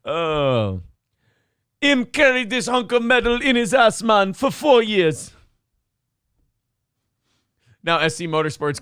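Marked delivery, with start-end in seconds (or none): 1.8-5.3 high-pitched Jamaican patter; Jamaican accent. Acted. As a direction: put on a comic Jamaican accent. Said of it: "you should be from Jamaica".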